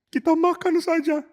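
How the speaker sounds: put-on high, small voice of a hurt girl; sad girl Tamar. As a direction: speak like a hurt young girl hiding pain. (suara gadis sedih yang tinggi dan kecil)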